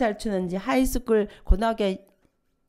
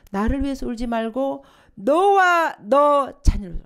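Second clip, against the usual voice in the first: slow, loud, solemn proclamation, stress on 너와; voicing Jesus; 3 semitones higher, 7 dB louder, slower than usual. Preacher quoting Jesus to the women of Jerusalem, slow and solemn. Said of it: "예수님께서 말씀하셨어요".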